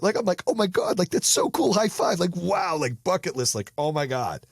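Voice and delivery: high-pitched, amazed gushing; good envy excitement. Acted. Showing good envy of friend's success, thrilled. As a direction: celebrate a friend's win like high-fiving him. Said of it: "you are happy for somebody else".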